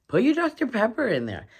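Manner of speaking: high, fast, bubbly instruction; playful excitement. Real, not acted. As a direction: toss it out with a bright burst of fun.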